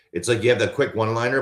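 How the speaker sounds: ordinary delivery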